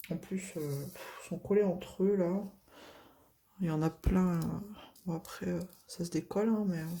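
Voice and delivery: normal talking voice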